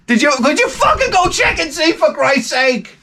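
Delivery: put-on high, strained outburst at the kid; exasperated yell. Dad to son who won't check, exasperated yell. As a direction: lose patience and yell it, stretching the end.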